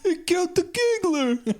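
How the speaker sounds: high wailing gang member; mock wailing. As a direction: mourn the giggler in a hammed-up howl. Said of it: "he says that a couple times".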